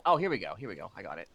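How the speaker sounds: usual speaking voice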